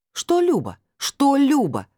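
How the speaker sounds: резко выше и громче, возмущённый выкрик; бросить «Что Люба?» с негодованием; возмущённо. Acted. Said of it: "возмутилась уже я". (возмущённый выкрик)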